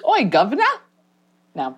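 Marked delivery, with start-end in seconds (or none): 0.1-0.6 put-on British accent. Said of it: "I can't do any accent work".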